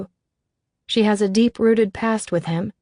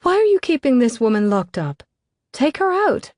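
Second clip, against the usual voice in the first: higher, louder playful scold; mock scolding; 6 semitones higher, 4 dB louder than usual. A narrator's reading. Davina scolding Declan playfully, high and loud. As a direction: tease him for hiding her. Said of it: "she scolds".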